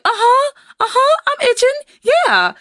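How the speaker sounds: put-on very high, excited little-kid voice; eager child. Child answering mom after playing outside, eager. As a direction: squeal it like an amped-up child.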